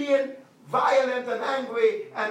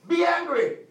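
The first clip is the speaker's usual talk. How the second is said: louder, higher, hit it like an order; emphatic command; 7 semitones higher, 6 dB louder than usual. Acted. Pastor commanding the congregation, emphatic preaching shout. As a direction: bark the command at the church.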